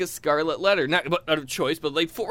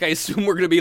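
ordinary delivery

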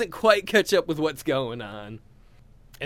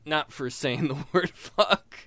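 ordinary talk

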